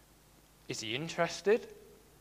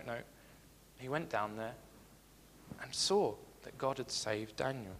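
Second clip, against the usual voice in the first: usual speaking voice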